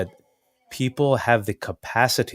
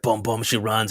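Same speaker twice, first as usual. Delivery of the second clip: ordinary talk, nothing marked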